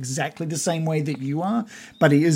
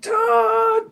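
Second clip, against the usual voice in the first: very high, drawn-out cries of names; squealed long-distance greeting; overjoyed calling; much slower than usual. Acted. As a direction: holler joyfully to friends far off. Character screams friends' names across distance.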